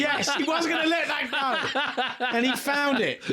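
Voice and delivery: laughing mock praise; chuckling through the words. Real, not acted.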